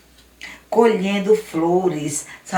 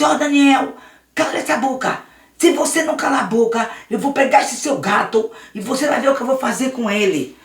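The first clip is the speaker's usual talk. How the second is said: bully him into silence; threatening bully; 5 semitones higher than usual. Acted. Luiz threatens Daniel.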